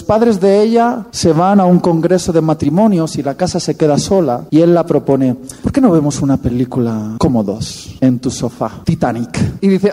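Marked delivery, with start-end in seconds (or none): 5.6-9.6 lower, softer coaxing voice; lower, hushed tempting suitor. Acted. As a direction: sweet-talk her onto the sofa. Boyfriend tempting her alone at home.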